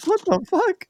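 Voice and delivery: a very high, disbelieving "What the fuck?"; stunned by "kitchen foil", going way up; incredulous. Real, not acted. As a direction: shriek the disbelief. Shocked at "kitchen foil" name.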